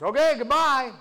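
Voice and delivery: loud, lifted, carefree farewell; breezy goodbye. Acted. Man leaving the angel at the elevator, breezy goodbye.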